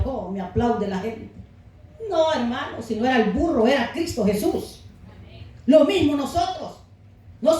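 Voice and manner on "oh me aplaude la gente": smug, lower mock voice of the vain donkey; proud donkey. Preacher voicing the proud donkey to the congregation, smug and self-satisfied. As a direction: preen like the donkey who thinks the applause is his. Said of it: "y él orgulloso decía".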